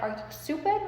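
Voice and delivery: a hint of shocked mom, high; incredulous mom. Mom reacting to the offer, incredulous. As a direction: ask it in disbelief. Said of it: "my mom's like".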